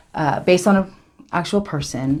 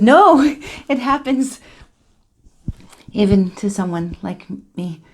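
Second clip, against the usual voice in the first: excited; 6 semitones higher, 4 dB louder than usual. Retold or imitated. Retelling her reply to friends.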